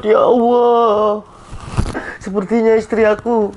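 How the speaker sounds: high, tearful lament with long drawn pleas; crying to himself. Acted. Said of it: "kamu kenapa nangis?".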